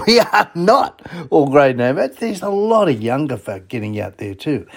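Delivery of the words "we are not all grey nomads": laughing through the repeat; amused repeat of the joke. Real, not acted. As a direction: chuckle as you say it again.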